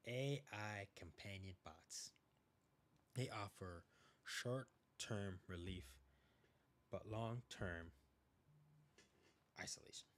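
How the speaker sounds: slow warning. Real, not acted. Stressing a warning.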